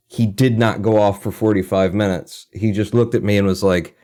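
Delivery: normal talking voice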